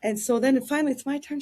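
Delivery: ordinary talk, nothing marked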